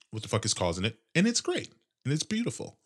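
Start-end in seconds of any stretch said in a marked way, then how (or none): 1.1-2.8 high, soft mock praise; sarcastic. Real, not acted. Host calls his bad day great, soft and sarcastic. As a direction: gush about it without meaning a word. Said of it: "It's not great and it's not beautiful".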